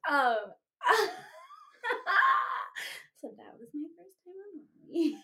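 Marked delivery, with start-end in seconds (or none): 0.8-3.2 very high, loud cackle; long helpless burst of laughter; real shrieking laugh. Real, not acted. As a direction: laugh till it squeals. Cracks up at texts.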